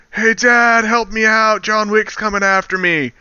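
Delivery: high, pleading bratty son; whiny kid. Retold or imitated. Spoiled mafia son whining to his dad. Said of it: "this mafioso asshole kid is like".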